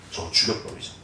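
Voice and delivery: a conspiratorial whisper; plotting whisper. Retold or imitated. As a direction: breathe it like a secret among brothers. Brothers plot murder secretly.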